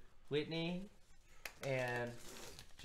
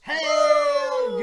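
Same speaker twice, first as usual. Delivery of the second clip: excited shout; 19 dB louder than usual. Real, not acted. Cheering the experience points.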